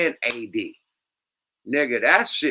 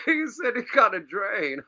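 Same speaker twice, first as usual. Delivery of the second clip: laughing disbelief; 8 semitones higher, 3 dB louder than usual. Real, not acted. Laughing retelling guest line.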